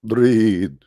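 angel commanding. Acted. Angel commands Muhammad to read.

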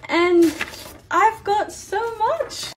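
high, drawn-out delight; excited. Real, not acted. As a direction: stretch it out with glee. Excited about fan mail.

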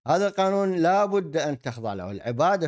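ordinary delivery

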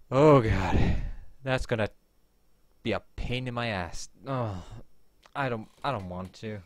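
usual speaking voice

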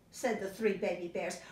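usual speaking voice

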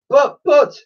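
high, loud stammer; squeaky desperate objection; pleading. Acted. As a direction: protest helplessly as a small boy. Boy pleading with cruel shopkeeper.

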